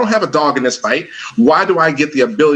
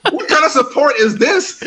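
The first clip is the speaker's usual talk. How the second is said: much higher, mock-outraged question; mock indignant; 13 semitones higher than usual. Son mocking his dad's lack of support, incredulous. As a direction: play up the disbelief.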